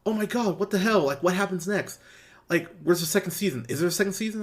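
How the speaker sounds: excited. Retold or imitated. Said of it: "Alea goes".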